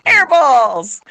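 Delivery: usual speaking voice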